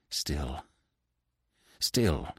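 low, very soft, hesitant stammer. A narrator's reading. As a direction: falter on the repeated word. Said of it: "she stammered".

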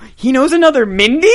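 high, loud, incredulous question; mock shock. Quoting Mindy's reaction to a revelation, mock shock. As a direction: blurt it as an astonished retort.

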